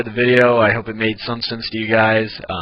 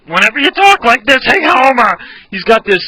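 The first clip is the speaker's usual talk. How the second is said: Barney; 12 semitones higher, 8 dB louder than usual. Shown as a demonstration. Showing viewers the idea of the voice, loud high rasp. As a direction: shout it high with a throaty rasp. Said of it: "I haven't sound exactly like Barney".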